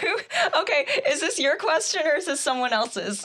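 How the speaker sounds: high-pitched, laughing through the words; giggly, caught-off-guard amusement; real laughter. Real, not acted. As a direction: ask it while cracking up. Amused by the unexpected question.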